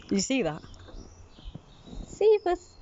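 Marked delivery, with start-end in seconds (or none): 2.1-2.7 much higher sing-song farewell; high goodbye to cat. Real, not acted. Owner trills goodbye to the cat. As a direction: trill a bye to the kitty.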